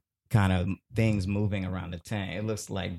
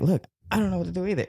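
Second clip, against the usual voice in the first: high, rapid mock Spanish-speaker voice; imitated Spanish speaker; 10 semitones higher, much faster than usual. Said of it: "like, in Spanish".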